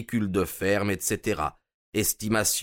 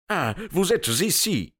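exclamation aiguë et vive; lancé haut, énervé; s'écrie; 8 semitones higher than usual. A narrator's reading. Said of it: "s'écria-t-il". (l'Américain énervé interpelle Holmes, s'exclamant)

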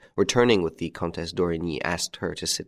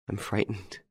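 much softer, frightened and tearful; the repeat sinks almost to a breath; frightened, fading; 2 semitones higher, 8 dB quieter than usual. A narrator's reading. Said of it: "She sobbed".

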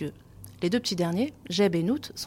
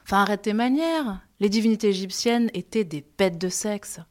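ordinary delivery

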